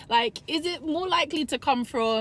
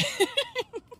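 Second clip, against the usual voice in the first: laugh. Real, not acted. Laughs after her point.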